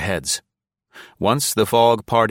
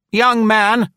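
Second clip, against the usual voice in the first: high, loud and grand, like a dowager; lofty old woman, loud and high; majestic old lady; 7 dB louder than usual. A narrator's reading. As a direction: address him from a great height. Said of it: "Nora answered majestically".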